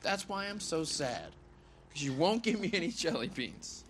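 high-pitched mock complaint; playful whining. Man begging his friend for jelly beans, playful whining.

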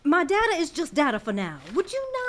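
loud, high, exasperated protest; heated objection. Acted. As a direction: snap at them to back off. Argument over unfinished results.

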